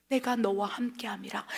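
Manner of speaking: soft whisper of 내가 너와 함께함이라; hushed, intimate whisper; whispered promise. Acted. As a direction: breathe God's promise like a secret. Quoting God's promise, whispered.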